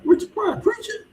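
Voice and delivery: high, amazed question; can't believe what he's seeing; surprised. Retold or imitated. Channel surfing, spots a Pryor lookalike preaching, amazed. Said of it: "I'm like".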